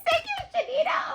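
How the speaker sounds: high, loud thank-you shout; excited thanks. Real, not acted. Thanking friend Shanita for the gift, shouted high with joy.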